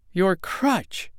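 high, mock scream without much volume; enraged exclamation. A narrator's reading. Little man rages at the girl's crutch, mild acted scream. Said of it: "screamed the little brown man in a passion of rage".

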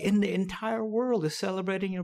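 teasing impression of cheerful well-wishers; quoting what everyone says. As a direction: playfully imitate people gushing. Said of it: "everyone always tells me that".

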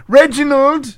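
loud posh summons. Acted. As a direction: call out imperiously. Q calls his assistant.

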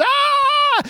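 very high-pitched mock scream; children losing their minds. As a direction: shriek like kids let loose. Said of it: "then they lose their minds".

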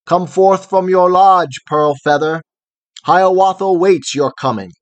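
loud, high ringing challenge. A narrator's reading. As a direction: call out a bold challenge. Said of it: "of his challenge loud and lofty".

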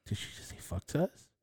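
unvoiced, shocked whisper; a friend whispering in shock at the table; stunned whisper. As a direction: whisper it in disbelief. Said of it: "my friend was like".

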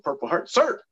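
high, loud answer to an officer; military reply. Retold or imitated. Staff sergeant answers the captain about his ribbon, sounding off loudly.

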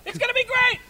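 high, fast, giddy hype; excited shout. Retold or imitated. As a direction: gush like a thrilled fan. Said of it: "telling everybody".

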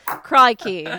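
loud hammed-up Australian Crikey with a falling tune; Australian accent. Shown as a demonstration. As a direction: shout Crikey like a cartoon Aussie. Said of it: "I can't really do an Australian".

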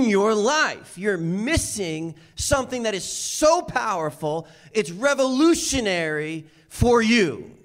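full-force preaching. Acted. Preacher urging the congregation, at full force. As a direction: press the point hard on the congregation.

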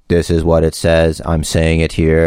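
low, droning monotone; colorless reading. Retold or imitated. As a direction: imitate someone reading notes with no color. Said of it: "If you can add some color to it, as opposed to your".

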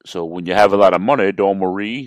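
a light, throwaway character impression; lightly put-on voice, close to your own; Kamala. Podcaster tossing in a joke wrestler impression, lightly imitated. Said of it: "That's my Kamala imitation".

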